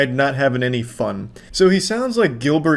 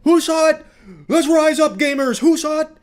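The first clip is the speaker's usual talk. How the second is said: high, hammed-up frantic pleading; rally the crowd in a squawky imitation; exaggerated high-pitched outraged appeal; mock rallying cry; 12 semitones higher than usual. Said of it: "tries to, like, rally the people".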